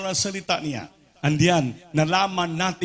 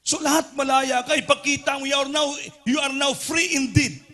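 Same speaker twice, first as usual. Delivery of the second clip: fiery preaching; 9 semitones higher than usual. Acted. Preacher rousing the church to shout in freedom, fiery. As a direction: whip up the congregation, calling it out high.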